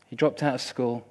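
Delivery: normal talking voice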